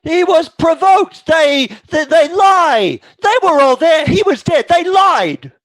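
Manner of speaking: high, loud, desperate protest; a widow defending her dead husband, near hysteria; distraught widow insisting they lied, voice high and loud. Acted.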